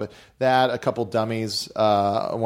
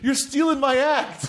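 much higher, slow mock accusation; slower than usual. Friend accuses the puppet of theft, higher and slow. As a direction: drag out the complaint. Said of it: "He's like".

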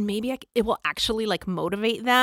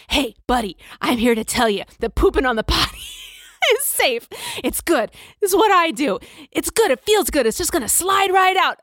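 Spider-Man pep talk; 7 semitones higher than usual. Retold or imitated. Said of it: "and he's like".

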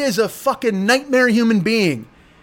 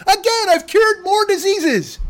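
high, slow sarcastic brag; slow high mocking tone; mock boast; 10 semitones higher, slower than usual.

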